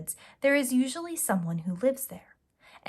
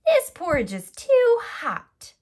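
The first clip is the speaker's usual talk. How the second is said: high, loud, slow whiny complaint; little girl wailing about hot porridge; Goldilocks complaining; 10 semitones higher, 10 dB louder, slower than usual. Acted. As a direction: protest like a fussy little girl.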